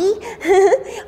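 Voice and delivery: bright amused laugh; light laugh. Real, not acted. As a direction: laugh at your own joke. Laughs at her own teasing.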